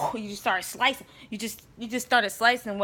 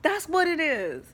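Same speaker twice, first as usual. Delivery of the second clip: much higher, delighted "oh, okay"; light up as it all clicks; sudden realization; 8 semitones higher, slower than usual. Retold or imitated. Retelling how the scripture made sense to her, high and delighted. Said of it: "I was like".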